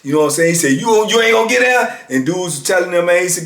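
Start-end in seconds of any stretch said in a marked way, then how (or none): 0.7-2.0 high-pitched mock threat; acted-out demand, pitched well up; retold threat. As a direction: imitate a guard challenging a defiant inmate. Retold guard threatening inmate.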